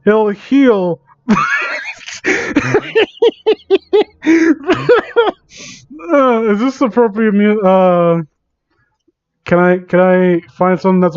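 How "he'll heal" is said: villain laugh. Acted. Villain answers, then cackles.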